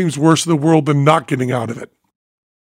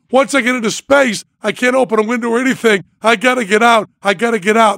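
higher, pitched-up panic; frantic pleading; 8 semitones higher than usual. Retold or imitated. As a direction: beg as the walls close in.

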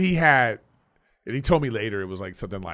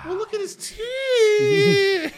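scared shriek; much slower than usual. Real, not acted. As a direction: yelp in fright. Scary ape on screen.

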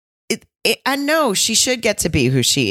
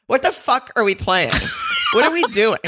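higher, laughing mock exasperation; baffled outburst; 9 semitones higher than usual. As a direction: act out comic bafflement at the game. Imagined baffled outburst at game.

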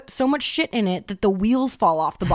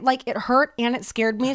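normal talking voice

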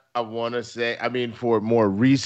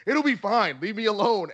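brushing it off, higher and brisk; dismissive friend; 7 semitones higher than usual. Retold or imitated. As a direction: wave off the worry. Voicing dismissive friend Kristen.

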